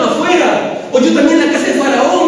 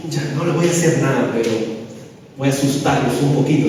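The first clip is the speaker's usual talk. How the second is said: scheming aside; 10 semitones lower, 7 dB quieter, faster than usual. Pretend. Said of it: "José hubiera aprovechado".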